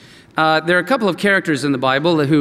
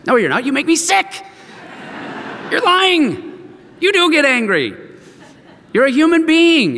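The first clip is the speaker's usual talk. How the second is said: forceful rebuke; 9 semitones higher than usual. Real, not acted. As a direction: confront the listeners head on, voice climbing. Preacher confronting pious pretenders.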